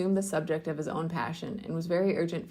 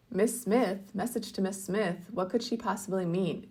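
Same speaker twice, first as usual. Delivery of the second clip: usual speaking voice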